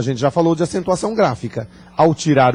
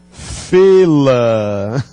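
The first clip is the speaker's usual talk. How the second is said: fê-la said louder, stress on fê; proud demo; 6 dB louder than usual. Shown as a demonstration. Teacher proudly presenting the finished example to class. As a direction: land fê-la with delight. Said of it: "Olha que lindo".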